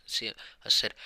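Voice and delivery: ordinary delivery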